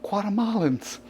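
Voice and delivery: Guatemalans drawn out and relished, each syllable tasted; enjoy saying "Guatemalans" for its own sake; savoring the word. Shown as a demonstration. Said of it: "I liked to say the word".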